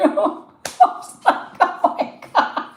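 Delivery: high, breathless laughing through the words; real laughing. Real, not acted. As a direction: barely get it out for laughing. Cracking up at her own story.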